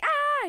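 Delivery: short high, loud squeal; mock scream. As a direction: imitate a squeaky player's scream. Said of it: "he starts screaming into the mic".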